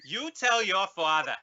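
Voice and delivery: high Boston mimicry of Markey; Boston Kennedy accent. Guest mocking Markey listing Kennedy brothers, high Boston accent. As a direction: rattle off names in a hammed Boston accent. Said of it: "a little bit of Ted there".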